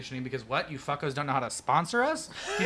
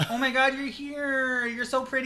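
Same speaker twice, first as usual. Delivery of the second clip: mock gushing squeal; 10 semitones higher, 4 dB louder, slower than usual.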